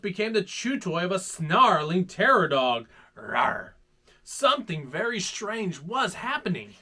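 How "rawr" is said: dog growl. Acted. Snarling terror dog.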